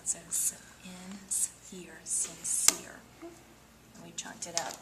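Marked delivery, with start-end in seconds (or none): 0.1-3.0 "s-, in, s-, ear" split, then "sincere" blended in a whisper; sound it out. Shown as a demonstration. Teacher breaking the word into parts for a student. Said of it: "Remember, sometimes C will make".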